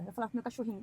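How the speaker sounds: ordinary talk, nothing marked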